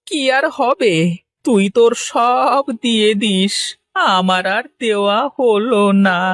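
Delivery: hammed-up crying; weeping despair. Ruined potter weeps, gives up.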